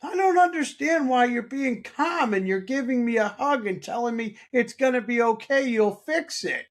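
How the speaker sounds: husband imitates wife asking why he is calm, high and bewildered; imitating upset wife. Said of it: "and she was like".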